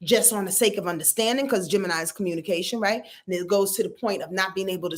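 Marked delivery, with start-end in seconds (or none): none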